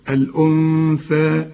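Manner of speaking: الأنثى with the nun hidden in a ghunna before the tha; nasal nun. Shown as a demonstration. Teacher pointing out the nun, slow nasal ghunna. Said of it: "خد بالك من النون".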